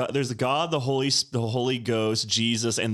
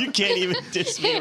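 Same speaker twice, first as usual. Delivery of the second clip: laughing; 8 semitones higher than usual. Retold or imitated. Laughing quote of character.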